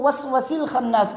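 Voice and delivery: "وسوس" with short a in the second syllable; shortened vowel. Shown as a demonstration. Teacher imitates the mistake, dropped long vowel. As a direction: say "وسوس الخناس" without the long aa. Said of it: "وسواس ال کو".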